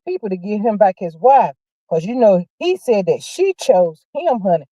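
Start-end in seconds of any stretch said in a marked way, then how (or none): none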